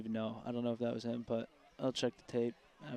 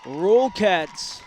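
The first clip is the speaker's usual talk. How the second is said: excited cheer; 7 semitones higher, 13 dB louder than usual. Real, not acted. Cheering the win.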